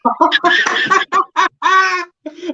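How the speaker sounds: high, helpless laughter; laughing. Real, not acted. Co-host reacting to the bad impression, high laughter. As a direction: crack up.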